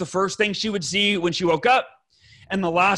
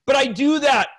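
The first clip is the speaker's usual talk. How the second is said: higher-pitched indignant protest; upset, defensive; 6 semitones higher than usual. Retold or imitated. Husband insisting to his wife he already does it, upset. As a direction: protest as if wrongly accused. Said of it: "and I get upset".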